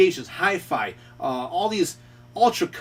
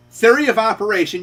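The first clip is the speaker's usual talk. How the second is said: Theory of operation said louder, THEORY hit hard; emphatic stress; 5 semitones higher, 6 dB louder than usual. Shown as a demonstration. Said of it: "you gotta walk step by step".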